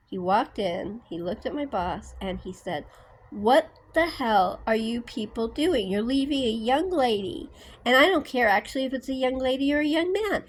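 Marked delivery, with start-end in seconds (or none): 3.3-10.4 retold rant, higher and louder, building; going off angry. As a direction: tear into the boss like he did. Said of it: "he was just going off".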